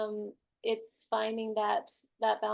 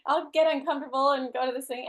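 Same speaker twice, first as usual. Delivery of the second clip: louder, quick, eager inner voice; mock eager resolve; 3 semitones higher, 7 dB louder than usual. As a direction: talk yourself into it, half laughing. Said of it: "it was like".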